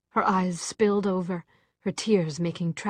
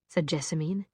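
plain reading voice

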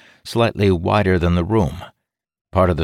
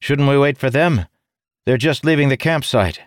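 pleading call, raised and higher; urgent shouting; 9 semitones higher, 4 dB louder than usual. Acted. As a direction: press them to wait. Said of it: "Ilden shouted".

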